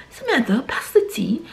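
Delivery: mock posh British tea-time voice; posh British accent. Soap character asking Samantha for the tea, mock British accent. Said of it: "that posh accent".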